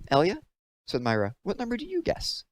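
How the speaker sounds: put-on airhead girl. A narrator's reading. Myra asks Elia.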